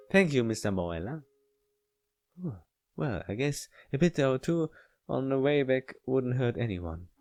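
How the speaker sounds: ordinary talk, nothing marked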